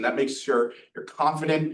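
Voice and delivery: ordinary talk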